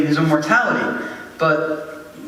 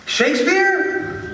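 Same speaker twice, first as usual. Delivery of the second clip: high, drawn-out astonished question; starstruck surprise; 7 semitones higher, much slower than usual. Acted. Young writer hears of his idol's new play, amazed. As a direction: gasp the name out slowly, pitched up. Hearing of rival's next production.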